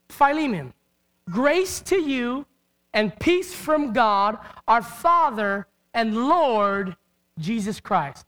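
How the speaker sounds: slow, solemn reading of the verse; slow scripture reading. Shown as a demonstration. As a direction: read each word slowly and solemnly. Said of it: "I want you to hear it".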